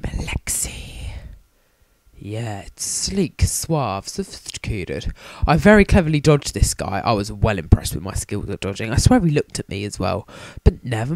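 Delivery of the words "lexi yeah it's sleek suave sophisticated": suave purr. Pretend. Savouring a name he likes.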